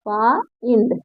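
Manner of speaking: "Find" softly drawn apart, फा then इंड; split into syllables. Shown as a demonstration. Teacher repeating the breakdown for the class, "find" split फा-इंड. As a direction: sound out "find" with a gap before इंड. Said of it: "आगे देखिए".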